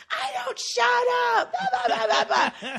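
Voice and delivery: high, slow, laughing mock shout of a pushy kid; mock shriek. Co-host mimics the girl screaming at a kid, high laughing shout. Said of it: "And she's like".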